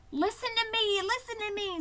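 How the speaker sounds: high, insistent goose cry; screaming goose. A narrator's reading. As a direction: demand attention in a high goose voice. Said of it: "screamed the goose".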